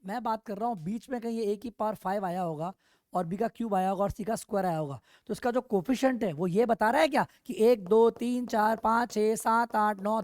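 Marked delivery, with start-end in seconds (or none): none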